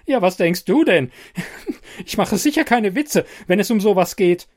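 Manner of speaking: high, strained, on the edge of a shriek; barely holding back a panicked scream; near panic. A narrator's reading. Insisting to Bernard it is no joke, near panic. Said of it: "kippt nahezu in ein panisches Kreischen".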